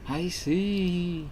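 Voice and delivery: a kindly spirit from beyond; ghost voice. Acted. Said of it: "This is my ghost voice".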